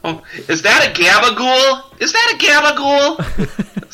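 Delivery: high laughing Italian-American voice; mock Italian-American accent. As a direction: squeal it like a mobster caricature. Mock Italian after Italian joke.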